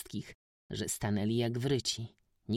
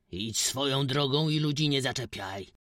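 put-on loud old man's warning shout; loud threatening warning; 3 semitones higher, 5 dB louder than usual. A narrator's reading. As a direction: shout him off, old and stern. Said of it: "krzyknął stary".

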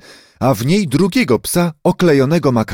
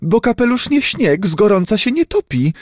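high, indignant snapping reply; angry retort; 8 semitones higher than usual. A narrator's reading. Hatbox owner retorts to the others in indignant anger. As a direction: spit it out in offended anger. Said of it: "wykrztusiła ze złością jakaś dama".

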